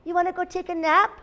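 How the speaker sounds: high, quick invitation; storybook cat. A narrator's reading. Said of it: "said the cat".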